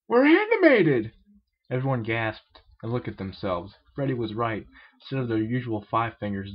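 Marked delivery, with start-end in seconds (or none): 0.1-1.1 high, loud, slow amazed cry; excited exclamation. A narrator's reading. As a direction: cry it out in astonishment. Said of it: "exclaimed Freddy".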